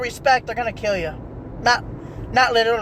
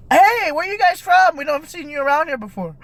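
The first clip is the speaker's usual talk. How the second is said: curious friendly local; 7 semitones higher than usual. Acted. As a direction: hail newcomers with exaggerated small-town curiosity. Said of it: "everybody that I passed by was like".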